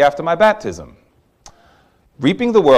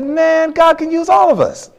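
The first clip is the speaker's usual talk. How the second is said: laughing realization; 14 semitones higher than usual. Retold or imitated.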